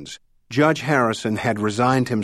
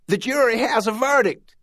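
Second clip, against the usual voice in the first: high-pitched call, held back in volume; shouted announcement; 11 semitones higher than usual. A narrator's reading. Paralegal alerting colleagues after the phone call, high contained shout. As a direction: call it out high, like news to the office. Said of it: "then shouted".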